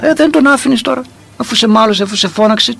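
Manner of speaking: high-pitched, acted remonstrance; imitated incredulous questioning. Acted puzzled questioning of elder. (απορημένη ερώτηση, σε μίμηση)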